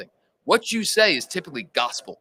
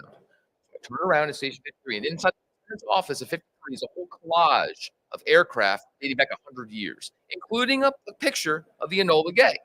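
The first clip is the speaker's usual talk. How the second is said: ordinary talk, nothing marked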